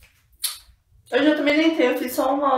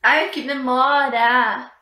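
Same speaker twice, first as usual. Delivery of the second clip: impatient groan; 4 dB louder, slower than usual. Real, not acted. Impatient sigh while waiting.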